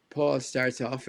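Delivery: ordinary delivery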